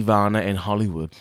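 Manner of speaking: usual speaking voice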